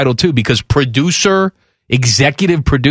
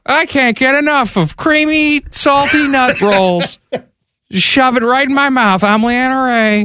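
high, sultry mocking ad read; mock female ad voice; 10 semitones higher than usual.

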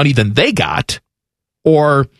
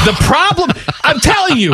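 excited insistence; 12 semitones higher than usual. Real, not acted. Insisting on hot take.

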